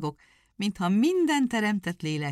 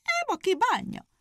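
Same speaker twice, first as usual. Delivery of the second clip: shout. A narrator's reading. Hare accepts the bet with a high shout. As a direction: call it out. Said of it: "kiáltott a nyúl".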